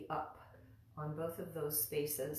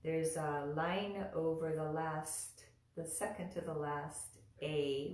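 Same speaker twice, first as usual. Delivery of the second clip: usual speaking voice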